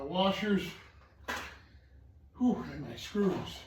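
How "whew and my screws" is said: winded and slow; out of breath. Real, not acted. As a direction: puff out the words, out of breath. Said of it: "I just get so winded".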